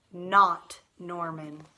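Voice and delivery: slow, weighty not Norman; slow emphatic refrain. Acted. Boy in the story rejects his goldfish, slow and firm.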